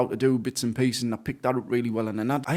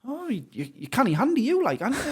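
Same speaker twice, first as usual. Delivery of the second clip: imitated amazement; 12 semitones higher than usual. Friend impressed at his handiness.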